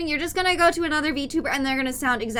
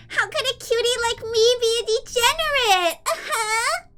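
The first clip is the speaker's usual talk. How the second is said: put-on much higher, sing-song cute anime girl; cutesy high voice; 9 semitones higher than usual. Shown as a demonstration. Streamer acting coy to chat in a high cutesy voice. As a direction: play it cute and innocent, pitched way up.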